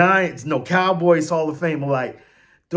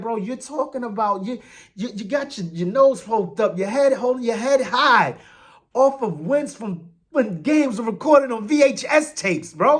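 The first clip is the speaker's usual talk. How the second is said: mocking taunt; 6 semitones higher than usual.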